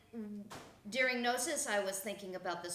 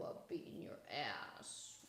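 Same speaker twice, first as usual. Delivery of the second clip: breathy whisper, much lower and softer; a hushed whisper to the dog; a secret whisper; whispered aside; 13 semitones lower, 13 dB quieter than usual. Real, not acted. Muttered aside to the dog Joe.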